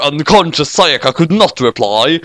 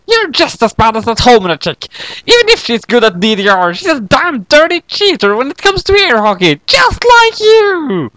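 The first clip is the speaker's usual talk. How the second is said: put-on high girl voice; 12 semitones higher than usual. Kyoko scolding Mister Bacon, high indignant girl voice.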